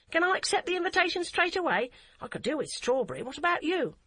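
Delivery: put-on high, quick voice of an excited eight-year-old; a keen child grabbing the offer fast; eager little girl. A narrator's reading. Child voice of Hadia.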